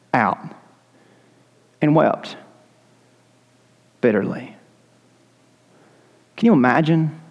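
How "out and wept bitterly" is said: hushed, grieving narration; sorrowful telling. A narrator's reading. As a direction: let the grief sink in. Peter weeping, solemn telling.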